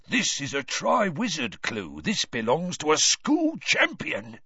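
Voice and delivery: lightly voiced sly caretaker; Filch's voice. A narrator's reading. Filch telling his cat about the clue, eager.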